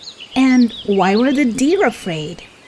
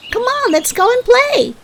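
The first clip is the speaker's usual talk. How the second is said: high, fast call to come and play; much faster than usual. A narrator's reading. Faline calling Bambi away to play, high and quick. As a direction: sing it out high and quick, calling across the grass. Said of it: "she called".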